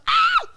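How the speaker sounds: very high, loud startled shriek; short comic scream of fright; mock scream. Retelling being spotted by the doorman, a mock shriek. Acts out fleeing people in fright.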